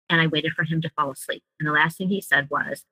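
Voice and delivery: normal talking voice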